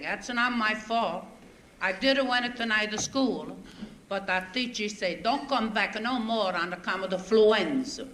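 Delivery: put-on broken English with a heavy Italian accent, comic storyteller; a thick Italian accent, told with a grin; Italian immigrant accent. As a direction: play the bewildered Italian newcomer telling his troubles. Stage comic in Italian immigrant dialect.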